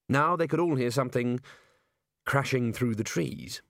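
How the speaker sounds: usual speaking voice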